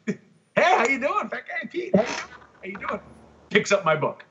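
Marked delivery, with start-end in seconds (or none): 0.1-3.0 cheerful big greeting. Retold or imitated. Greeting him at the table.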